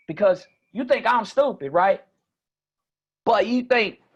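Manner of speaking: high, loud Mississippi country voice; Southern Mississippi accent. Shown as a demonstration. Introducing himself as a Mississippian in Phoenix, high Southern accent.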